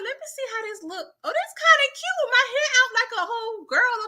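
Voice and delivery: high, light voice, admiring herself; preening in the mirror, lifted up high; Juke's girly voice. Retold or imitated. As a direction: play a girl pleased with her new braid. Juke admiring her braid in mirror.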